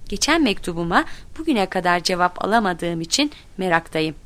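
ordinary delivery

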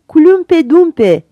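high, loud and slow drawn-out call; shouting. A narrator's reading. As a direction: yell the name to drown the others. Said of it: "diye bağırdılar". (bağırarak, uzatarak)

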